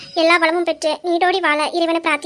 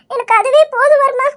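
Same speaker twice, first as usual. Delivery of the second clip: louder, tearful outpouring; emotional insistence; 4 semitones higher, 6 dB louder than usual. Acted. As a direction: insist with a full heart. Wife says his trust is enough. (நெகிழ்ச்சியோடு உறுதியாக)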